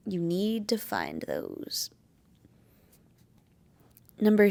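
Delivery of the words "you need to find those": softer emphatic repeat. Shown as a demonstration. Vlogger to viewers, repeating advice softly and slowly. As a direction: drop the voice and slow it down, pressing the point.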